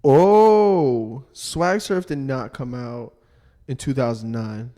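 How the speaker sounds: loud surprised oh, then slow. Real, not acted. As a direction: gasp at the news, stunned. Surprised at the date.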